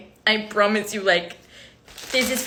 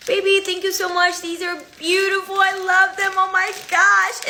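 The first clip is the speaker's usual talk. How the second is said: much higher, sweet gushing thank-you; 10 semitones higher, 3 dB louder than usual. Retold or imitated. Girlfriend thanking boyfriend for roses, high and gushing. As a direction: coo it like a delighted girlfriend. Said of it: "I call my boyfriend saying".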